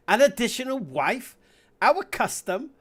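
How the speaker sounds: loud, high, rising questions; indignant disbelief. Acted. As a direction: throw the words back as outraged questions. Reads outraged wife's reply.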